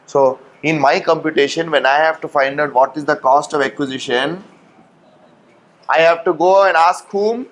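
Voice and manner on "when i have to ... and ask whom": usual speaking voice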